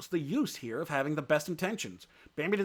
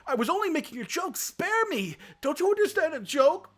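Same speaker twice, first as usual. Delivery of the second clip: much higher, strained pleading voice; pitiful begging; 5 dB louder than usual. A narrator's reading. Beaten Ronno pleading with Bambi to spare him, high whine. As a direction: whine for mercy, high and desperate. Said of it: "begged him pitifully".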